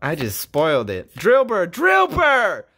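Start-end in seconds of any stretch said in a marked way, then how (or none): 1.1-2.6 excited. Real, not acted. Excited call on pull.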